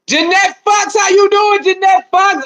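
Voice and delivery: high, drawn-out sing-song hello; sing-song high greeting. Real, not acted. Streamer greeting a regular viewer, high and sing-song.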